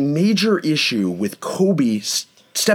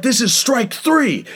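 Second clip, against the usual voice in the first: higher pitched mock angry neighbor; imitating an irritated gym guy, raised pitch; 8 semitones higher, slower than usual. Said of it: "He's like".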